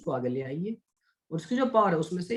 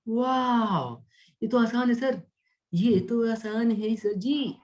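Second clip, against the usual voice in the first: high, drawn-out mock wonder; impressed student; 4 semitones higher, much slower than usual. Teacher voicing students delighted by the shortcut, mock amazed. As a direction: play a pupil amazed at how easy it is.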